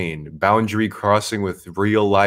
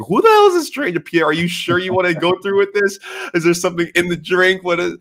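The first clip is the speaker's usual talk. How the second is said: much higher, louder, alarmed viewers' voice; high, louder, mock-alarmed viewers asking who this stranger is; panicked chat voice; 10 semitones higher, 3 dB louder than usual. Voicing the chat freaking out.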